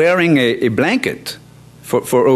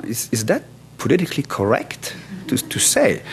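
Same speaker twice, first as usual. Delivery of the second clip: muttered to himself, wondering if it's allowed; quiet inner doubt; 6 dB quieter than usual. Retold or imitated. Inner doubt as he looks at her.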